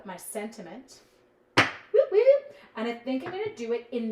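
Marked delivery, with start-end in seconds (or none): 1.6-2.5 two high, loud whoops; quick squealed whoop-whoop; playful whoop. Real, not acted. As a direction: let out a pair of bright, high whoops. Playful noise while grabbing supplies.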